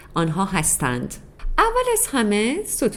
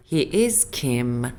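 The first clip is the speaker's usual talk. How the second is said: slow model sentence; 6 semitones lower, much slower than usual. Shown as a demonstration.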